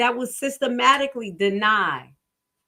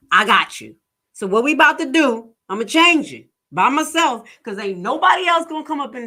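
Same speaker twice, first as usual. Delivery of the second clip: retold loud reassurance; 3 semitones higher, 7 dB louder than usual. Retold reassuring patient loudly.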